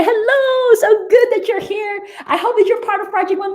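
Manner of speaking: light up as you spot a friend; excited greeting. Real, not acted.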